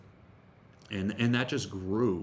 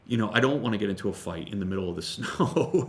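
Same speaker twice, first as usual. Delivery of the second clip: ordinary talk, nothing marked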